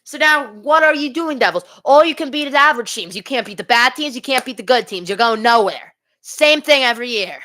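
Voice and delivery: high, loud fed-up scolding; frustrated rant. Real, not acted. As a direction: berate your team like an exasperated fan. Fan rants at losing team.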